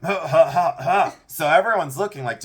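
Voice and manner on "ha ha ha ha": forced little cough-laugh; imitated mom laughing. Son mimics his mom at the funeral, mock laughing. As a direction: mimic the mother's nervous fake laugh. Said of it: "so she goes".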